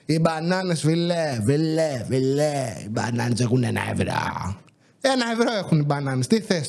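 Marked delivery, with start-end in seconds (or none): 0.1-4.6 mock customer drone. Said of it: "Κάνε υπομονή".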